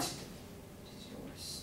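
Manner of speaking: soft breathless whisper, drawn out slowly; whispered farewell. Acted. Lover parting from his sweetheart, whispering. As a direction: breathe it to her alone.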